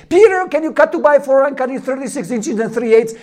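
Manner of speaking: high, loud call from up on the roof; loud, hurried roofer shout; yelling from a roof. Retold or imitated. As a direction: shout it down to a worker below. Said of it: "this guy on the roof screams".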